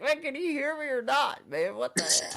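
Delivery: playfully frustrated at the silent caller; hammed-up annoyed call into a muted mic; exasperated quote. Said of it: "I was like".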